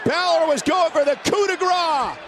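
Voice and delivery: usual speaking voice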